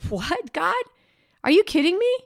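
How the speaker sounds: missionary stunned that God says tear it up; shocked disbelief. Retold or imitated. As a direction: act floored by the reply. Missionary shocked at God's answer.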